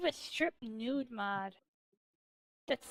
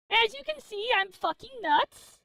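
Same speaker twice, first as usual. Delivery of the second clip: mock crazed; 5 semitones higher, 5 dB louder than usual. Shown as a demonstration. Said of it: "said twice, then repeated".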